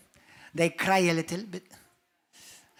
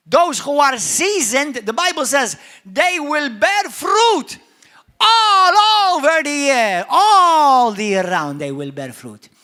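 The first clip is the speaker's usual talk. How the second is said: loud, slow, high preaching; fiery pulpit proclamation; preaching at full force; 6 semitones higher, 14 dB louder, slower than usual. Acted. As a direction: build to a roaring sermon climax.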